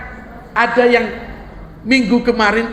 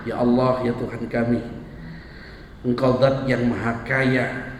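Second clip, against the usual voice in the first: preacher's humble closing prayer; 9 semitones lower, 3 dB quieter than usual. Acted. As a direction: pray earnestly for the congregation.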